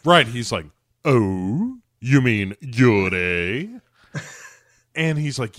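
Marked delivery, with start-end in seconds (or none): 1.1-3.8 slow, drawn-out imitation of a knowing elder; mock-portentous, savoring the last word; George Takei. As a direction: stretch it into a loaded question. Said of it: "is telling George Takei".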